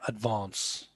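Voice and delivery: "advance" with a long British "ah"; British accent. Shown as a demonstration. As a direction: softly say "advance" as "ad-VAHNS", British style. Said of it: "How does he pronounce it?".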